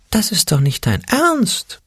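very high, slow little squeak; a tiny scared creature piping up; squeaky fearful voice. A narrator's reading. The Angstpeter squeaks his doubt at Ole, very high and slow. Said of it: "fiepste der Angstpeter".

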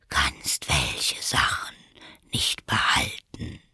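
low, soft whisper; caterpillar. A narrator's reading. The caterpillar coolly contradicts Alice in a whisper. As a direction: dismiss her in a low whisper.

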